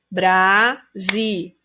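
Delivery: "Brasileiros" split into BRA, SI, LEI, ROS; slow teacher's bra... si... lei... ros; syllable by syllable. Shown as a demonstration. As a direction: count out "Brasileiros" in four separate syllables. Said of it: "Quatro sílabas".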